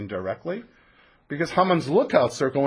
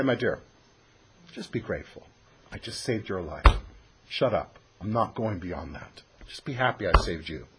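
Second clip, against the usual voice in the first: quieter and lower, a king brushing her off; a hint of a smug king, quiet and low; dismissive king; 7 semitones lower, 5 dB quieter than usual. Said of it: "what he might turn around and say".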